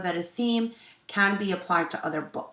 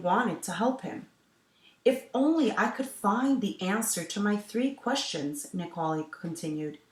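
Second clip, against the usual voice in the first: story narration; 2 semitones higher, 5 dB louder than usual. A narrator's reading. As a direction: clear storytelling voice for children. Teacher reads picture book aloud.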